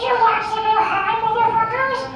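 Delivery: high-pitched creepy creature croak; Gollum. Acted. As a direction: squeeze it out high like a wretched creature. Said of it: "that's Gollum from Lord of the Rings".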